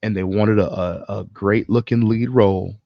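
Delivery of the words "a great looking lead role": usual speaking voice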